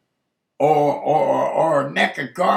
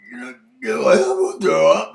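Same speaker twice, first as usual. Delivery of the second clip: mock nausea; 4 semitones higher, 5 dB louder, faster than usual. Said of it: "And she goes".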